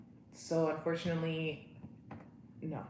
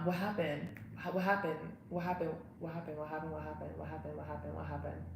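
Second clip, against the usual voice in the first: soft, rattled-off repetitions; hushed pestering; 3 semitones lower, 9 dB quieter than usual. Pretend. Mimicking curiosity about an awkward moment, hushed pestering. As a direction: mutter it over and over.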